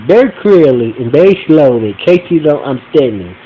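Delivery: ordinary talk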